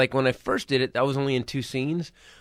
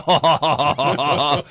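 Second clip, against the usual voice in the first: horror laugh; 3 dB louder than usual. Retold or imitated. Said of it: "I started to horror laugh".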